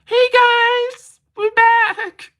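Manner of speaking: very high-pitched, squeaky put-on impression; Nubby. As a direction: push the voice way up for a cartoonish greeting. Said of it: "do a Nubby impression".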